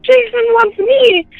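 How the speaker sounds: high, drawn-out imitation of a hysterical boy; retold hysteria. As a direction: stretch it high like a frightened child. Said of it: "he was just hysterical".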